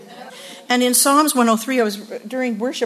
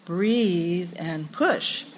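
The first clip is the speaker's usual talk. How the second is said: drawn-out, very slow Lamaze coaching; slow breathy coaching; 4 semitones lower, 3 dB quieter, much slower than usual. Pretend. Mocking Lamaze coach drawing out breathe and push. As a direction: stretch it out like a birthing coach.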